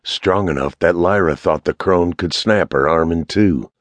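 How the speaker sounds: plain reading voice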